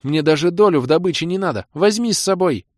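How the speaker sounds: high, loud insistence; eager pleading. Acted. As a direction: beg loudly, pitch up. Mage keeps pleading.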